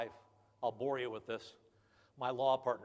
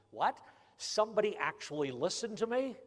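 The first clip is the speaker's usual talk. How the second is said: mock surprise; 7 semitones higher than usual. Real, not acted. Surprised by credit union.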